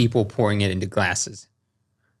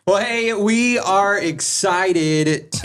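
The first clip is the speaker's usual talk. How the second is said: ad read; 7 semitones higher, much slower than usual. Acted. Starts sponsor read.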